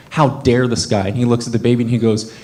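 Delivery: usual speaking voice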